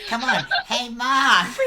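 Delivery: put-on high, very loud child's holler; kid yelling. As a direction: call for mom through the house like a small boy. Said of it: "you hear him yelling".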